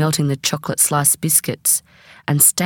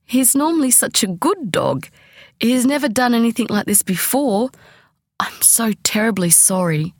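higher, flustered apology trailing to a whisper; apologetic; 7 semitones higher than usual. A narrator's reading. Mum flustered and apologising to the guest. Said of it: "Still apologising".

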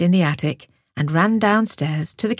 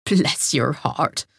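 put-on slow, lower, smiling voice of an old hedgehog; 5 semitones lower, much slower than usual. A narrator's reading. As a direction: say it with a fond smile. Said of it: "smiled Hedgehog".

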